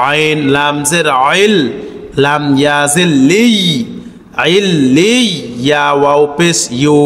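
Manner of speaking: measured Quranic spelling chant; correct letter pronunciation. Shown as a demonstration. Teacher asks viewers to note true letter sounds, chanted carefully. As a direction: recite evenly, lengthening the final syllables. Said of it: "মূল হরফের সঠিক উচ্চারণটাও লক্ষ্য করবেন".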